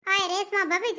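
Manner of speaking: usual speaking voice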